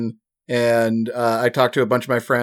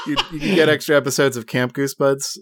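laughing; 3 semitones higher than usual. Real, not acted. Laughing after own embarrassment.

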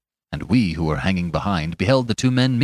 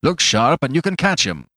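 higher-pitched, urging him on; urgent innkeeper's call; landlord's urgent cry; 6 semitones higher than usual. A narrator's reading. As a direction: cry out a hurried tip. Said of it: "cried the landlord".

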